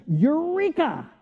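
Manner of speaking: high, slow excited Eureka; exclaimed Eureka. Retold or imitated. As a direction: draw it out like a joyful discovery. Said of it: "he says".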